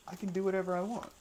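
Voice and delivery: high, fast, cocky comeback; defiant retort. Retold or imitated. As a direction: toss it off defiantly. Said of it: "And I say".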